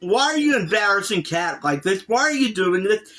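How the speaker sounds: ordinary talk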